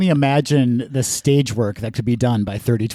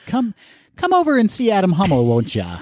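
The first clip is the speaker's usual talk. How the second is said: mock advert pitch; 8 semitones higher than usual. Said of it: "if you need a stereotyped Russian accent".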